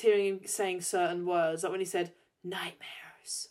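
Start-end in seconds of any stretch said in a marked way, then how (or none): 2.4-3.5 hushed, voiceless impression of the actor; imitated whisper. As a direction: whisper the single word theatrically. Imitating actor saying one word.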